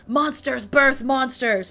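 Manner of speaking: slow, lightly shouted accusation; shouting villager. Retold or imitated. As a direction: call it out slowly like an angry villager. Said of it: "they're shouting things like".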